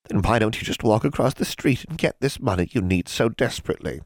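high, sceptical witch voice; hag voice. A narrator's reading. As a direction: prod her like a shrewd crone.

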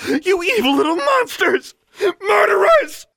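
very high, loud screaming tirade; enraged shriek. A narrator's reading. Alexandra screaming at the elves, shrieking accusation. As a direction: scream it with tearful fury. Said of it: "Her voice rose to a shriek".

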